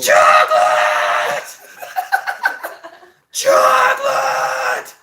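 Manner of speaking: very high, slow, raspy scream. Retold or imitated. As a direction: shriek the word out, stretched and breathy. Screaming the episode catchphrase.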